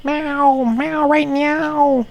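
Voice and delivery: high mewing cat voice, dragged out; squeaky kitty meows. Pretend. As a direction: meow like an impatient kitten. Cat-voice demand.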